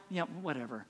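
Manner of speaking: eye-roll whatever. Retold or imitated. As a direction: throw it away with an eye roll. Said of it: "look at you with the eye roll".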